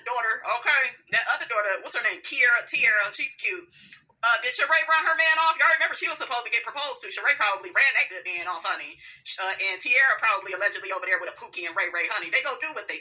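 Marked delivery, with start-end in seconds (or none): none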